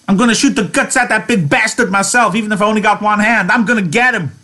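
high, loud, fast vow of revenge; vengeful Curly. Acted. Curly vows to kill Lenny himself, furious. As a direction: spit out the threat.

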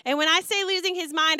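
ordinary delivery

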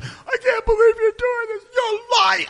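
very high, hammed-up sobbing whine; mock-cry the accusation in a squeaky wail; imitated crying. Crying housemate accusing the guy who lied, mock crying. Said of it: "He's crying because he's like".

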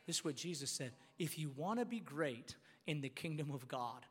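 low, very soft, reverent teaching; hushed preaching. Real, not acted. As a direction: drop right down to a quiet, grave explanation.

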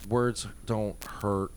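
slow, deliberate and dropping; slow emphasis. Real, not acted. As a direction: lay the words down slowly. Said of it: "emphatic point".

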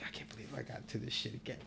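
very soft, fast whisper to himself; disbelieving whisper from the closet; hiding naked, whispering in disbelief. Retold or imitated.

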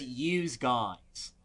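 put-on "Yous guys" in a light Philly accent. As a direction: drop a lazy Philly yous. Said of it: "But he says".

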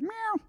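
a high, squeaky little meow; mock cat meow. Pastor joking about figurative cats with a mock meow.

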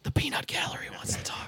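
hushed unvoiced aside; a joking whispered aside about the others. Real, not acted.

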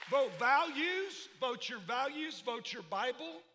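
loud, slowing preacher's shout; preaching climax. Acted. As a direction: build to a full-force call to vote.

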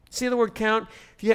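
normal talking voice

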